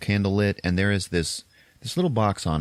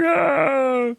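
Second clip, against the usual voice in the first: very high, drawn-out pained cry; mock scream; 4 dB louder than usual. Priest losing his ear, with a high mock scream. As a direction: wail high, as if badly hurt.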